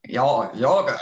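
"yogurt" drawn into an open "yaw-gurt", after a false start "Y'all"; imitated odd pronunciation. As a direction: mimic his "yogurt" loosely, almost a yodel on "yaw". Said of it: "He says it like".

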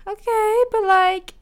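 high, sing-song "okay, okay", grudging; mock reluctant okay. Podcaster mocking fans' praise, high grudging okay. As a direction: humour them with a lilting high okay. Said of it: "And I'm like".

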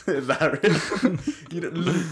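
asking through laughter; laughing. Real, not acted. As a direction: laugh while you ask. Laughing at an absurd name.

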